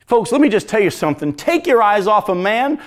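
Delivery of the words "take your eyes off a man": ordinary talk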